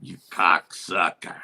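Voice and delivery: low, slow gangster growl; gruff low curse. Retold or imitated. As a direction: growl it like an old mobster. Quoting Paulie cursing.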